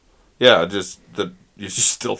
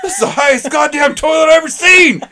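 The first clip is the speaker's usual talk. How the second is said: loud, high-pitched exclamation of disbelief; retold outraged shout; 6 dB louder than usual. Storyteller acting out his friend in the toilet, outraged shout. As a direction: yell it as the stunned man in the stall.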